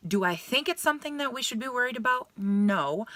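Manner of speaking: high-pitched retold question, then a plain-spoken no; imitating reassuring doctor. Gynecologist explaining to her patient, imitated reassurance. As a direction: pose it high, then answer it yourself.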